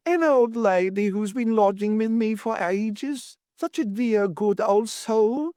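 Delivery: high-pitched, fond landlady; distressed Mrs Worth, fond. A narrator's reading. Landlady remembering her old lodger, high and fond. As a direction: speak high, lingering on the praise.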